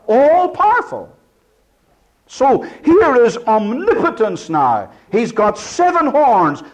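rising sermon fervour, all power in the voice; preaching at full force. Acted. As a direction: build it up like a pulpit climax.

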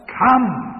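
high, loud, falling call of "Come."; Savior's ringing invitation. Acted. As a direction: call "Come." out across the hall. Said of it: "says the Savior".

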